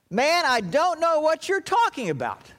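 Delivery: cornered man loudly brushing off a third accuser; heated denial. Acted. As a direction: snap it out, fed up with being asked.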